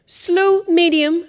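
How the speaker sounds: ringing out instructions to a class; called out. Real, not acted. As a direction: announce each tempo to the group. Teacher calling out the tempos.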